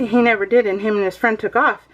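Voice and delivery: normal talking voice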